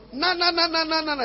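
high teasing na na na; mock them in a childish chant; sing-song taunt of church hoppers; mocking singsong. Mocks people hopping churches.

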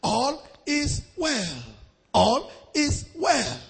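slow, drawn-out refrain; measured mock-soothing delivery; mock reassurance. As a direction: imitate preachers lulling their flock, word by word. Mimics preachers soothing their members.

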